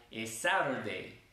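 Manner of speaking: "es Saturday" with an "eh" before the S; Spanish-speaker's "es Saturday"; added E. Shown as a demonstration. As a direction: put a stray E in front of Saturday. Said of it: "le metemos una E al principio".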